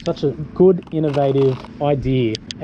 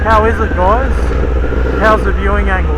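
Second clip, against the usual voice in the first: muffled voice inside a motorcycle helmet; talking into a helmet mic while riding; 2 semitones higher, 6 dB louder than usual. Real, not acted. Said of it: "I've got the visor closed on the Ruroc Atlas".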